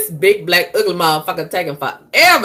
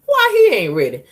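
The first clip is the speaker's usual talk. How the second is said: usual speaking voice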